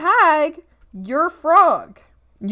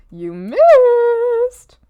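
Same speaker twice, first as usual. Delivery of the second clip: much higher put-on mocking voice; high mocking repeat of the taunt; sing-song taunt; 10 semitones higher, 4 dB louder than usual. Taunting a missed shot.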